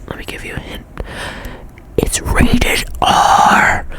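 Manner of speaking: secretive whispered hint. Real, not acted.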